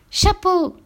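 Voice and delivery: put-on high, excited child's cry; child's exclamation. A narrator's reading. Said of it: "s'exclama-t-il". (exclamation d'enfant, très aiguë)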